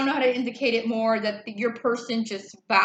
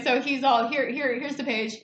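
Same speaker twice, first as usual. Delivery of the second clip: ordinary talk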